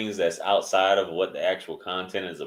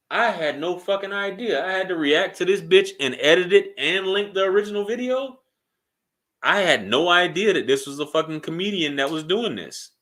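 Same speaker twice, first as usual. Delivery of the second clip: high-pitched, hammed-up disbelief; mock astonishment; 8 semitones higher than usual. Reacting to learning it's a skit, high mock astonishment. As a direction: feign total shock in a raised voice.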